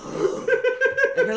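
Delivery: sharp, very high intake of breath; bugbear's stunned high gasp; high breathy gasp. Acted. Bugbear's astonished exhale.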